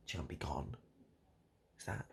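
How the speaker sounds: ordinary talk, nothing marked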